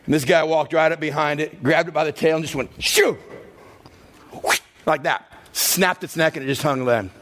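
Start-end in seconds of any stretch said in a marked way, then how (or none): 2.8-3.2 whip snap noise. Retold or imitated. Imitating snake snapped like whip.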